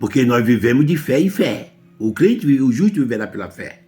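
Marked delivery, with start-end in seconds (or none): none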